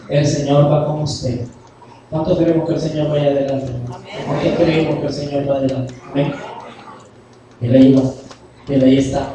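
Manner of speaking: usual speaking voice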